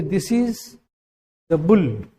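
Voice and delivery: "the" said as a short "thuh" before "bull"; "the bull" with a reduced "the"; weak the. Shown as a demonstration. Teacher showing students how "the bull" sounds. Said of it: "तो द बोले".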